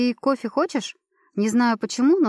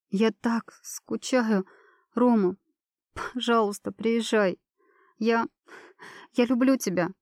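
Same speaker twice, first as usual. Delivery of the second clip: crying on the phone; 3 semitones higher, slower than usual. Acted. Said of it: "начинаю реветь, рыдая в трубку".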